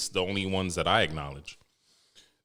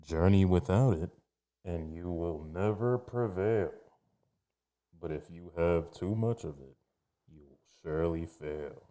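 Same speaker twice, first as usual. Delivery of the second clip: a hint of a menacing riddle-teller; Riddler voice. Shown as a demonstration. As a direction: recite it like a sly comic-book riddler. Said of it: "I should have did that with my Riddler voice".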